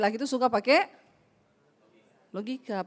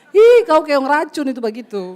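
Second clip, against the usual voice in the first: louder, exasperated rebuke; loud scolding; 10 dB louder than usual. Real, not acted. Preacher loudly rebukes men who just sleep and wait. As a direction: scold the lazy ones loudly.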